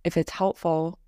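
measured Yoga Nidra narration, little pitch movement; guided meditation. Acted.

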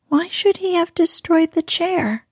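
ordinary delivery

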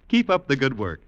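ordinary talk, nothing marked

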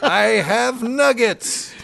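put-on slow, high old-man shout; screaming Polish old man. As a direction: bellow it slowly like a cranky old man. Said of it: "old screaming Polish men".

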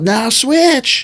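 high-pitched mock shout; imitated yell. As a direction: call it out like a cornerman mid-fight. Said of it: "the guy yells out".

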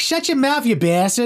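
high mock Boston retort; imitated Boston bartender. Waitress telling off Boston Mike, high mock Boston accent. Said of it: "And she's like".

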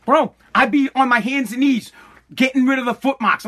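ordinary talk

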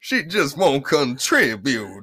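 Southern debutante. Pretend. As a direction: play an old Southern debutante. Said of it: "You sound like an old debutante".

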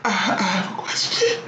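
high, soft fake sobbing voice; mock crying. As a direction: pretend to cry through the words. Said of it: "clearly he's crying".

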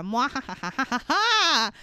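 slow evil cackle; mad scientist laugh. Retold or imitated. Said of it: "Lo Pan's like".

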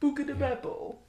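exaggerated fake Italian accent. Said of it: "Does it not sound like fake Italian gibberish?".

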